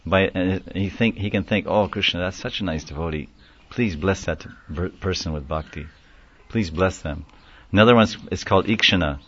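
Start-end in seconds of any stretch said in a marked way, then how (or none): 1.7-7.3 soft imitated prayer. Devotee's silent prayer for someone.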